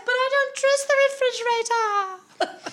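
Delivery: very high, loud mock wail; hammed-up kitchen maid in a panic; frantic Daisy.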